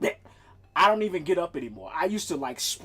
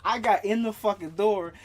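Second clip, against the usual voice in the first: ordinary delivery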